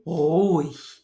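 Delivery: "Ruhig" with a long rolled uvular r; dramatic trilled r. Shown as a demonstration. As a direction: trill the r of "Ruhig" at the back of the throat. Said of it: "That sounds kind of dramatic".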